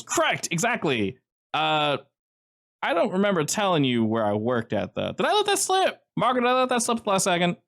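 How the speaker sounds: high, bright suspicious banter; Joe's voice. Acted. GM voices Joe, higher pitch.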